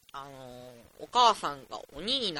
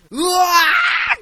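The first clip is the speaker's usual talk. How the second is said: loud, higher, strained slow groan on うわー; all-out effort cry; 10 semitones higher, 13 dB louder, much slower than usual. Retold or imitated. Forcing himself back into the dream, straining loud. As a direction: force yourself back into sleep. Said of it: "全力で".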